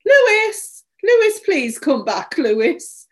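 high, loud call into the distance; desperate calling. A narrator's reading. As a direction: call the lost dog back, pleading. Owner calls for her lost dog.

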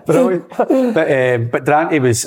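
ordinary delivery

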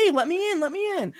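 high squeaky plea through the window; pleading to be let in. Retold or imitated. Friend retelling a movie, voicing a girl begging at the window. Friend acting out knocking character.

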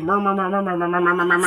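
run-on mumble of indistinct voices; murmur-murmur crowd babble; imitated background murmur. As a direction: imitate muffled chatter behind a door. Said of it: "You hear, like".

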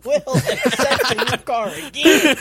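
slow quote through laughter; drawn-out imitation broken by laughing; laughing movie quote. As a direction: crack up while imitating the line. Laughing while repeating a film quote.

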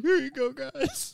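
put-on high sweet baby talk; talking to fish. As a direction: coo it at your pet fish.

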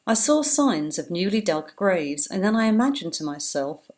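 usual speaking voice

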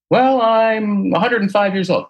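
slow, high-pitched mock old person; excuse-making old client. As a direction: plead great age in a high, drawn-out voice.

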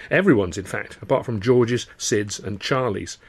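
ordinary delivery, nothing marked

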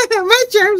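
very high squeaky "My germs"; mock creepy voice. Retelling the "My germs" bit, squeaky high voice. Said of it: "He goes".

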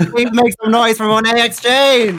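high, loud show-host announcement; hyped guest introduction. Real, not acted. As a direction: call the guest out to a cheering crowd.

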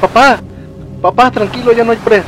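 anxious soothing. Acted. Child begging crying father to calm down, anxiously. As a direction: plead with him to calm down.

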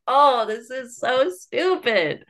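high, drawn-out retold groan of annoyance; hammed-up, slow disbelief; exasperated. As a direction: stretch it out in exaggerated exasperation. Acting out own exasperated reaction.

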